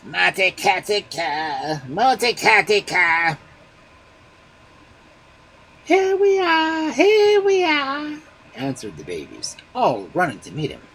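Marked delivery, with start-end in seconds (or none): 0.1-3.4 loud, slow ogre call. A narrator's reading. Ogre calling for the baby, loud and slow. 5.8-8.2 very high, loud, slow shouting; a crowd of babies calling back. A narrator's reading. Babies answering the ogre, very high and loud.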